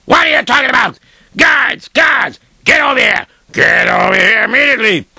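high furious yell; angry shout. Acted. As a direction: bellow for the guards. Owner yells for guards.

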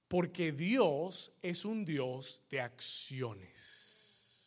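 low, soft, deliberate sermon teaching; solemn preaching. Real, not acted. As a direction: draw the congregation in quietly, stretching key words.

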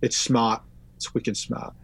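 put-on light, low-key Boston accent; casual mock-Boston accent. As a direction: slip into a soft Boston accent. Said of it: "I'm talking in a Boston accent".

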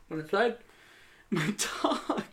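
usual speaking voice

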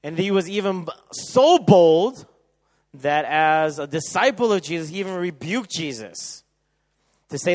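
loud, high preaching at full force; fervent preaching. Acted.

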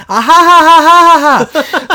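high, loud villain cackle; unhinged villain. Acted. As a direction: cackle like a madman. Maniacal laugh over game list.

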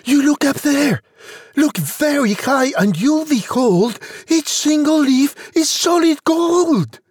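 put-on high, excited young Jack voice; high-pitched insistent child; crying out boy. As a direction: urge Mum to look, pitched well up. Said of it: "cried Jack".

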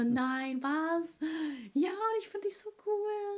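high, gushing imitation; excited fan. Retelling a fan encounter, high gushing voice. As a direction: play up the fan's delight.

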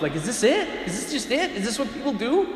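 high, rising string of questions; acted youthful exasperation, pitched high; restless questioning. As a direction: question it all, voice climbing. Acting out his restless younger self.

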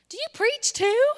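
high, loud incredulous question; astonished uncle. Retold or imitated. Uncle astonished at his niece, high mock amazement. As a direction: ask it in high-pitched amazement, acted out.

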